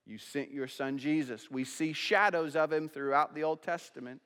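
prayer. Acted. Leads prayer over music.

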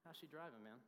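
a lightly voiced small-town Kansas guy asking a favor. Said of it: "you get".

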